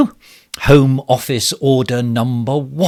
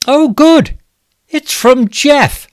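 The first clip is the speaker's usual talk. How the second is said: mock delight; 14 semitones higher, 4 dB louder, slower than usual. Minister feigns delight at question.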